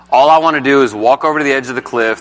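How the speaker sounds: ordinary talk, nothing marked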